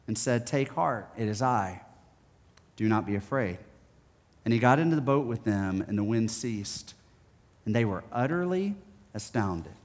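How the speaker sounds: soft, measured Bible reading; scripture reading. Acted. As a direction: read the passage gently and solemnly. Reads scripture passage.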